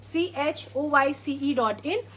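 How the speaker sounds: C H O Y C E said letter by letter; each letter of C H O Y C E given separately; spelled out. Real, not acted. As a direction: spell C H O Y C E slowly, one letter at a time. Spells out her website address.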